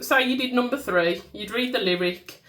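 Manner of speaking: ordinary delivery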